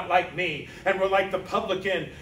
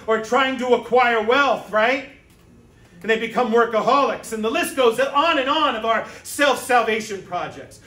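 usual speaking voice

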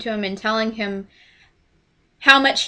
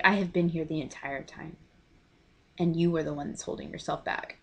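soft, lowered and reassuring; gentle reassurance; 4 semitones lower, 9 dB quieter than usual. Retold or imitated. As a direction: comfort him quietly. Jesus answers Judas, retold.

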